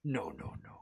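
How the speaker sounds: very low, hushed voice of dismay; master's voice. Pretend. Game master voices the player's master, low hushed dismay. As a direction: drop deep and soft, a master dismayed. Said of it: "You hear your master's voice go".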